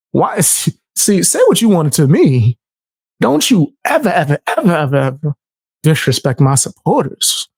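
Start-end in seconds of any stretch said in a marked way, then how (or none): none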